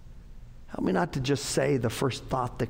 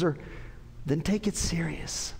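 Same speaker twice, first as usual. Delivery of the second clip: usual speaking voice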